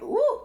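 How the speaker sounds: sudden high-pitched "ooh" of wonder; a quick high squeal of amazement. Retold or imitated. Imitating the power hitting.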